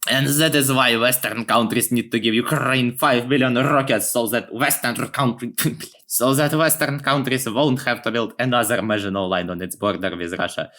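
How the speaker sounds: put-on heavy accent with rolled Rs; mock-official Ukrainian propaganda speaker. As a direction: deliver it as a hammed-up propaganda announcement.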